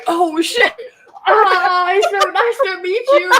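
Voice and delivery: startled, high, laughing greeting. Retold or imitated. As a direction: yelp in surprise then force a polite hello. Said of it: "and he's like".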